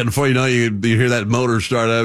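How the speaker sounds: usual speaking voice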